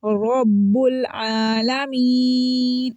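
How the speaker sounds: रब्बुल with thick rounded r, "robbul"; slow chant of रब्बुल आलमीन, heavy first letter; heavy ra recitation. Shown as a demonstration. Teacher showing ra with zabar, thick and drawn out. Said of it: "रॉ मोटी हो रही है".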